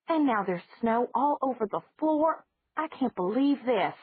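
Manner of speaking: high, firm scolding voice; a lightly voiced aunt telling her off. Aunt Judith scolds Margaret about the open window, mild high scolding.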